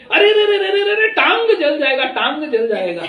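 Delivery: high-pitched, panicky mock cry; shouted warning. Woman warning about hot kadhi, high imitated shout. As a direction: imitate an alarmed yell, starting very high and falling. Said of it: "तो उधर से चिल्लाई".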